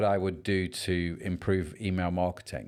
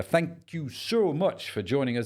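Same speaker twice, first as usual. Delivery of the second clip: slow, higher goofy voice; silly put-on voice; 7 semitones higher, slower than usual. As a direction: stretch out a daft, high sign-off. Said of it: "did that stupid voice".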